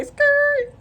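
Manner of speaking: skirt squealed out high; high-pitched mock skid noise; imitated tyre screech. Driver acting out pulling into the driveway, high tyre screech.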